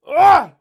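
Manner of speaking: sudden loud yelp of pain; hammed-up stab scream; mock pained scream. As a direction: scream like the knife just went in. Acting out being stabbed.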